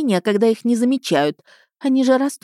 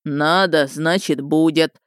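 rumbling gnome voice; 2 semitones lower, slower than usual. A narrator's reading. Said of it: "загрохотало опять".

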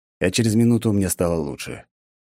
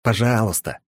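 forced politeness; 4 semitones higher than usual. A narrator's reading. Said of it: "добавил".

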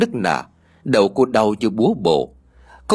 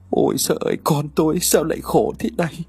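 tearful lament; 4 semitones higher than usual. A narrator's reading. Mother-in-law near tears over Huê's fate, tearful lament. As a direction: grieve aloud over her daughter-in-law. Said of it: "Hai mắt của bà rưng rưng".

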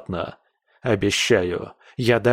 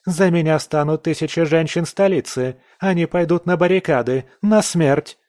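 fiery Pamela; 6 semitones higher than usual. A narrator's reading. Pamela threatens Ronald, higher and fiery. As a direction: rouse the women to battle.

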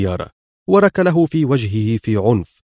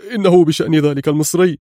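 higher, eager, rushed informer voice; full of excitement; 5 semitones higher, 3 dB louder, faster than usual. A narrator's reading. The henchman tells Pancho about the Egyptian, higher and excited. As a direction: spill the key news eagerly. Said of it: "بلهجة تحمل كل انفعاله".

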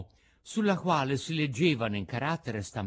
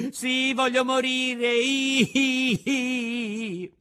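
high, loud crying wail; despairing wail; 9 semitones higher, 5 dB louder, much slower than usual. A narrator's reading. Pinocchio despairing at the fairy's grave, high wail. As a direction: burst into drawn-out acted bawling. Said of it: "si disperava a questo modo".

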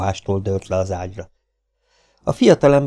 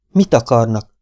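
"Mit" pressed, louder the second time; insistent repeat; 3 semitones higher, 6 dB louder than usual. A narrator's reading. Mabel to the intruding cowboys, asking again louder. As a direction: demand it louder, no longer asking politely. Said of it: "kérdezte újra Mabel".

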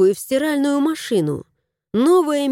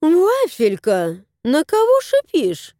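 lightly voiced woman, slow on the name then much higher; woman scolding the cat, pitch jumping up; fussy woman; 6 semitones higher, slower than usual. A narrator's reading. Хозяйка окликает кота, голос взлетает вверх.

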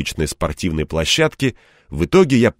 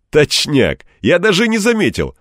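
much higher burst of amused realisation; exclaiming; 10 semitones higher, 4 dB louder than usual. A narrator's reading. Man agrees with assistant about their bickering, exclaiming. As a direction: exclaim it high and lively. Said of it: "воскликнул я".

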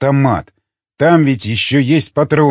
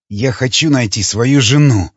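usual speaking voice

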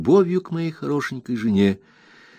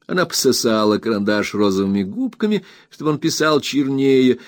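usual speaking voice

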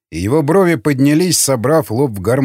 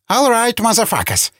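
high-pitched indignant threat; squealing anger; 9 semitones higher than usual. A narrator's reading. Insulted man starting on the prisoners, squealing anger. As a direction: squeal it at them, fired up. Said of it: "завизжал тот".

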